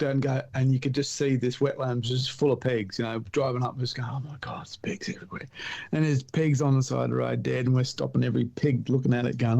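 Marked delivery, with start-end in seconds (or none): 4.1-5.5 breathless whisper of amazement; whispered awe. Retold or imitated. Whispering to a mate at the wetland full of pigs. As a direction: hush it like you can't believe your eyes.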